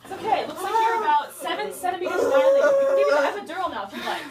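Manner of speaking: high, loud urgent coaching, laughing through it; mock urgent doctor. Doctor coaching a screaming patient, loud and half-laughing. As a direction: shout calming orders while cracking up.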